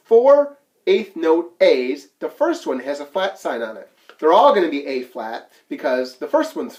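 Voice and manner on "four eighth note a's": slow and deliberate, a bit louder; slow, emphatic teacher pointing; slowed for emphasis. Shown as a demonstration. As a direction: spell it out for the students. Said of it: "pointing out the notes".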